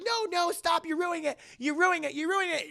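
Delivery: high, whiny mock panic; frantic protest. Mother stopping the interruption, high frantic protest.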